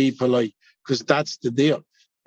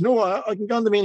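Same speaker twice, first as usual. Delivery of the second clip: higher and fast, stumbling nervously; flustered reply; 7 semitones higher, much faster than usual. Retold or imitated. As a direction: blurt it out in a nervous rush.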